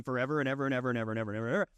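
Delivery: fast, rattling string of evers; rapid-fire forever. Real, not acted. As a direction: race through the evers to show it never ends. Piling up endless evers.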